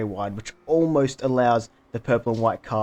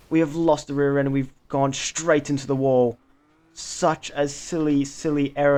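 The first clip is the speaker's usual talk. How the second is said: higher-pitched, agitated crash commentary; dismayed excitement; 3 semitones higher, 4 dB louder than usual. Real, not acted. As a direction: exclaim in real dismay as your race falls apart. Driver crashes own car, engine gone.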